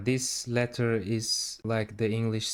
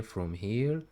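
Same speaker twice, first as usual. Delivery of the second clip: ordinary talk, nothing marked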